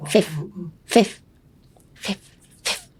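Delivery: "Fifth" as a short puff of air on the F; huffed F. Shown as a demonstration. Teacher drilling the start of fifteen, huffed. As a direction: cut "Fifth" off in a little huff. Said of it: "little huff at the end".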